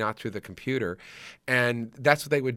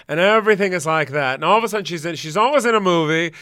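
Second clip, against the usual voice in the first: loud, high mock mid-Atlantic; theatrical old-Hollywood imitation; Bette Davis accent; 6 semitones higher, 8 dB louder than usual. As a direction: ham up the grand star voice. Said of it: "she's always talking like this".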